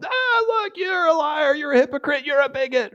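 high, hammed-up accusation; mock accuser. Onlookers accusing us as the world burns, mock outrage. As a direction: point the finger with a high mocking voice. Mimics accusers pointing fingers.

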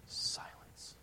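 breathy whisper of one word; hushed dramatic whisper. Real, not acted. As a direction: let the word hang in a whisper. Dramatic pause after prayer.

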